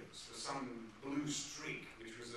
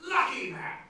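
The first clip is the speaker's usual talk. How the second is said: Lucky Man! burst out high and loud; excited discovery; 10 semitones higher, 10 dB louder than usual. Retold or imitated. Finding the title at last, an excited high shout. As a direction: shout it like a eureka.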